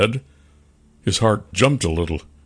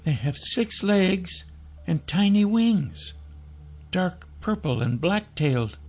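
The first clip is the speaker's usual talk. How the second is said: put-on high child's voice; high-pitched, earnest kid; informing little boy; 10 semitones higher, 4 dB quieter than usual. A narrator's reading. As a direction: describe it as a wary small boy. Boy describes dragons.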